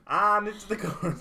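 laughing out the words. Real, not acted. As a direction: giggle through it. Laughing about their song.

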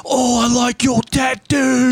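put-on high, slow, pushy Australian accent; aggressive Australian. Stranger on the beach pushes a compliment, aggressive Australian. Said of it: "he was like Australian ... super aggressively".

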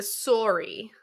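"sorry" with a rounded "sore-ee" vowel; "sorry" rhyming with "story"; Canadian sorry. Shown as a demonstration. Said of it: "For me, it's not the oots".

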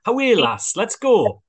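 hammed-up Northern English accent. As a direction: play a cheery Northern English casting agent. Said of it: "break in a Northern accent anytime you want".

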